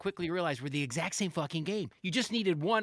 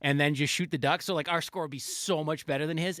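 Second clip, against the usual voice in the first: ordinary talk, nothing marked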